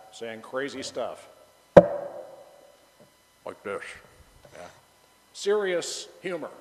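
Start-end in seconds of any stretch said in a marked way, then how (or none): 1.8-4.7 deadpan demo. Shown as a demonstration. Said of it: "he would talk like this".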